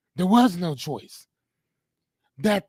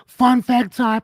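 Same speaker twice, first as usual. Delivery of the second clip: excited announcement; 7 semitones higher, 6 dB louder, slower than usual. Acted. Announcing a fun fact segment.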